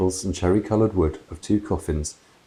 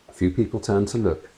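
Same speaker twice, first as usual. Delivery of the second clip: usual speaking voice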